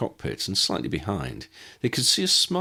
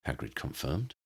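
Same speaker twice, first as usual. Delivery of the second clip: usual speaking voice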